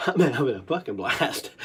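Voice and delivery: normal talking voice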